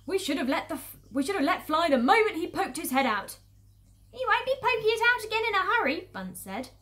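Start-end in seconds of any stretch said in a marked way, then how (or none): 0.0-3.4 loud, raised-pitch complaint; vexed and forceful; annoyed farmer. Acted. Angry farmer regrets the late shot. 4.1-6.1 high, loud, quick character voice; squeaky-pitched farmer, talking fast; second farmer's voice. Acted.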